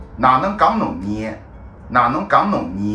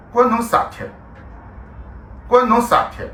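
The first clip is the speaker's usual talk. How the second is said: indignant retort; 9 semitones higher, faster than usual. Acted. Rude retort to the driver.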